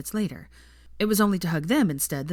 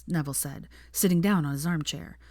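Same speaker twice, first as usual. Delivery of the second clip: plain reading voice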